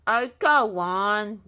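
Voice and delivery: wolf coaxing the pigs, sniffling. A narrator's reading. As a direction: draw it out through a stuffy nose. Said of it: "sniffled Big Bad".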